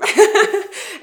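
high, loud burst of self-mocking laughter. Real, not acted. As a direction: laugh it off at yourself. Laughs at being a foreigner.

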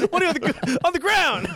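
high, laughing through the words. Real, not acted. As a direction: squeak it out while cracking up. Laughing hard at the image.

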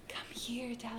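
whispered summons to a parent; secretive, hushed call; urgent whisper. Retold or imitated. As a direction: whisper so nobody outside hears. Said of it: "Like, I'm whispering".

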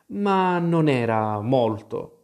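usual speaking voice

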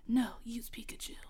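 whispered faraway voice, no tone; distant voice. Acted. Redoing the voice line as a distant whisper. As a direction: breathe it from far off. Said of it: "it's like a distant voice".

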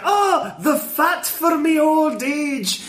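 high, slow, laughing Scottish voice; Scottish groundskeeper imitated, laughing; Scottish accent. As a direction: put on a Scots groundskeeper. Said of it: "groundskeeper is like".

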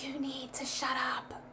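slow, hushed whisper. Retold or imitated. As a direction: imitate a very calm, voiceless whisper. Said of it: "Like, I'm whispering".

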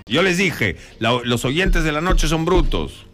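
hammed-up outburst at the audience; mock rant. Host rants at the listeners. (despotrica en broma contra los oyentes)

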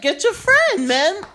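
high, laughing get your friend; half-laughing, pointed plea to collect his friend; pointed look in words. Retold or imitated. Silently urging stranger to act.

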